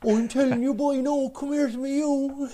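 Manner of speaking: put-on high, sing-song Cork accent. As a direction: ham up a Cork lilt. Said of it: "the beautiful Cork tones you have".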